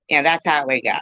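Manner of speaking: usual speaking voice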